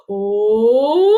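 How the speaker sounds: loud "oh" sweeping upward through the range; primal howling sound. Shown as a demonstration. Voice teacher showing a watery primal sound, flowing howl. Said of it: "a sound you can make could be something like this".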